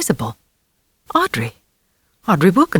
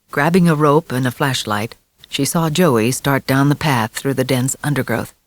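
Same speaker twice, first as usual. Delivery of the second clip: usual speaking voice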